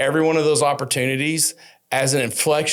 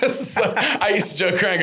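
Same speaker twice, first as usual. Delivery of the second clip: laughing; 7 semitones higher than usual. Real, not acted. Laughing at own story.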